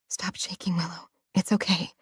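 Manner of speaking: soft whisper, soothing herself; whispered self-comfort. Acted. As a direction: breathe the words to calm your own shaking. Calming herself inside, trembling.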